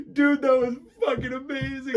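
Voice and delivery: high, loud mock amazement; imitated excitement. Imitating teammates praising the accent prank, loud excitement. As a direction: gush like a delighted gamer.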